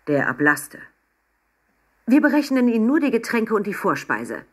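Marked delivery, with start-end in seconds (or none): 2.1-4.5 higher-pitched, prim reproach; pointed, snippy. A narrator's reading. Waiter presents Papa the bill, pointedly. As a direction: say it with a sharp edge. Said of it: "sagte der Kellner spitz".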